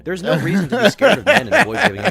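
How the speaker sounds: mocking a creepy guy's chat through laughter; laughing mock read. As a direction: send up the message while cracking up. Mock reading of a creepy message.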